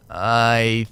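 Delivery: very low, slow and unsure; hesitant groggy reply. Acted. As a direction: drag it out low, just woken up. Groggy reply to baffling caller.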